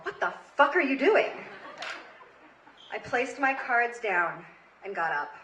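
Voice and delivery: ordinary delivery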